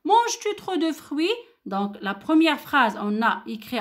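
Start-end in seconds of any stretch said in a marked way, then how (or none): 0.0-1.3 "Manges-tu trop de fruits?" said high with the voice rising; question intonation. Shown as a demonstration. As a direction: ask it clearly, voice going up. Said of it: "je répète". (intonation interrogative montante)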